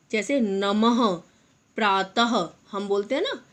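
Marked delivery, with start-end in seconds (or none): 0.4-2.5 नमः slowly, ending in a breathy h; नमः and प्रातः with a clear final h; visarga as h. Shown as a demonstration. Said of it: "इनका उच्चारण हम ह की तरह करते हैं".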